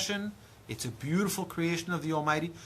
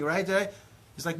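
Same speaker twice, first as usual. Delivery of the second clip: ordinary delivery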